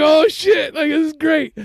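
put-on gleeful kid voice; kid's delight. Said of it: "he was like".

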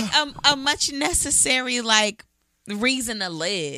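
holding back tears; choked up, voice wavering; tearing up. Real, not acted. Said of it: "My sister's tearing up".

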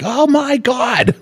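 high, slow shocked cry; mock shock. Grandpa voicing granddaughter at the mirror, high mock shock. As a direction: gasp it out like a kid seeing her face. Granddaughter sees drawn face.

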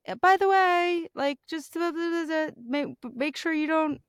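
hammed-up nagging wife, slowed down; mock nagging self. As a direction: imitate her own naggy reminder. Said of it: "I was just like".

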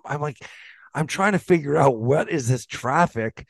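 ordinary talk

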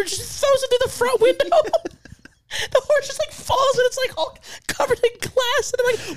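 high, strained laughing talk. Real, not acted. S0 retelling the horse scene while laughing. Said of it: "laughing while retelling".

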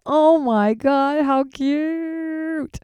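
high, very slow gushing. Retold or imitated. Hearing the father's story about his son, slow high gushing. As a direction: melt over it like it's adorable.

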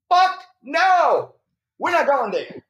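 sharp, high and loud refusal; mock outraged wife. Wife shutting down the Ferrari dealership idea, high and loud. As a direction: bark it out like the shocked wife.

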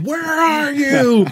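loud, high, drawn-out holler; long high mock shout across the woods; retold yell. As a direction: yell it like the angry dude in the story, stretching each word.

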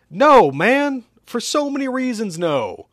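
high, loud rejection; yelled, high-pitched exasperation; outraged refusal. Acted. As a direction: refuse at full volume, then drop.

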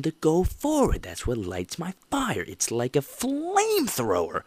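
slow, stretched-out impassioned delivery; fired up. Real, not acted. Host on what drives him, fired-up fervor. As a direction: draw out the key words with fervor.

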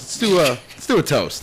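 loud, high call to refill; rally the room for a toast. Real, not acted. Calling for another toast.

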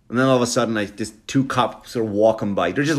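usual speaking voice

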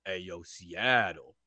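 low, slow, drawn-out social-media persona; Russell Wilson. Retold or imitated. Imitating the quarterback in bed talking into his phone, low slow drawl. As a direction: drop low and stretch each word, laid-back. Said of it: "Russell Wilson on social media".

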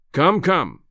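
mild rumbling impatience; rumbled impatience. A narrator's reading. Professor hurrying his visitor along, mildly rumbling. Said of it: "he rumbled".

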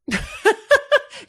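a burst of high-pitched laughter. Real, not acted. Laughing at the thought of being an older escort, high.

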